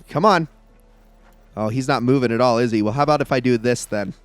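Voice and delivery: ordinary talk, nothing marked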